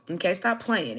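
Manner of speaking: ordinary delivery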